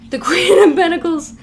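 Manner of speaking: amused card reveal; laughing. Real, not acted. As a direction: giggle through the card name. Laughs while announcing card.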